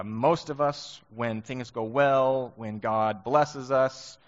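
slow, weighted build-up; slow deliberate. Real, not acted. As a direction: draw each phrase out. Slowed emphatic build.

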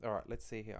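ordinary talk

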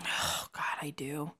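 barely voiced embarrassed whisper; whispered groan. Real, not acted. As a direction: breathe it out, mortified. Embarrassed about emo albums.

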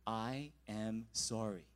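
drawn-out sincere apology, low and slow; slow solemn apology. Retold or imitated. Groomsmen demonstrating the key words, slow and earnest. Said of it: "They're like".

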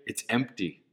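a hint of sulky disappointment; quiet glum verdict. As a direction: mutter it like a letdown. Acted voice looking at glass.

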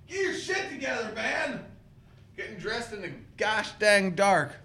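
high, exasperated grumble at himself; frustrated mock scolding. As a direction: scold yourself in a silly voice. Scolding himself over the collar.

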